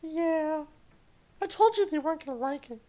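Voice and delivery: put-on sassy high falsetto girl; snooty anime girl, very high; high girly voice. Character voice again.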